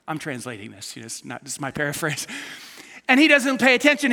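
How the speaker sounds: ordinary talk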